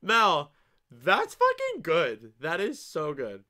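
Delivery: loud, drawn-out, emphatic admiration; delighted praise. Real, not acted. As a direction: savor the praise slowly and loudly. Delighted reaction to a viewer's punchline.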